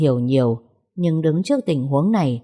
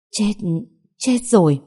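horrified gasp; 5 semitones higher than usual. Acted. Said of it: "Bà hoảng hốt rụt tay lại".

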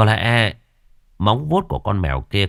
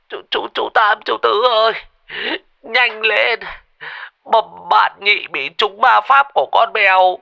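much higher, louder, crying and panicky; hammed-up sobbing plea; 13 semitones higher, 5 dB louder than usual. Thọt sobs on the phone begging the brothers to hurry. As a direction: sob down the phone begging for help. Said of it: "lão Thọt thút thít".

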